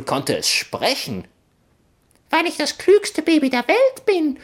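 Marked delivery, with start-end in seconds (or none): 2.3-4.4 very high, squeaky smug tiny voice; clever baby voice. Acted. Baby in the suitcase boasts to the boys, very high. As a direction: brag like a know-it-all infant. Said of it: "tönte es aus dem Koffer".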